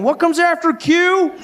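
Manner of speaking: high, dopey question; a silly high mock voice; dim-witted person. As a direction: ask it like a clueless kid. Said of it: "still people going".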